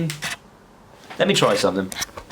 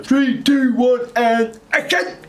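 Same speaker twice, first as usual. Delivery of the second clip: high, drawn-out director's shout; action call; 11 semitones higher, 4 dB louder, much slower than usual. Retold or imitated. Crew counting down an advert shoot, high drawn-out call. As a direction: call the countdown out across a set.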